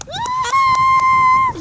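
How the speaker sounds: shrill cheer of delight; excited high whoop. Real, not acted. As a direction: whoop it out loud. Celebrating dog's run.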